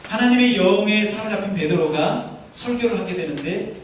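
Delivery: normal talking voice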